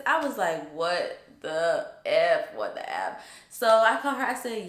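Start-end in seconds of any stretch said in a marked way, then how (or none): 0.7-3.2 drawn-out, dropping to a low repeat; stunned disbelief. Retold or imitated. Student realising the whole computer was tracked, stunned. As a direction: let it sink in, slow then low.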